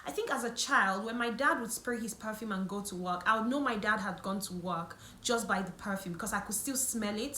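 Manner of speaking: ordinary talk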